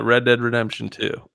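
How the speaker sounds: ordinary delivery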